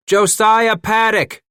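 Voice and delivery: long, loud, high drawn-out call. Acted. Bass hailing his partner from afar, slow loud call. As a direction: stretch the name out slowly so it carries. Calling out across a stream.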